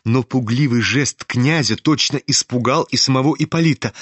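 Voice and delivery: plain reading voice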